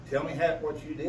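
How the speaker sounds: usual speaking voice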